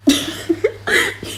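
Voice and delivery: airy rising laugh; soft breathy laugh. Real, not acted. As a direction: let out a breathy chuckle. Laugh after fumbling a name.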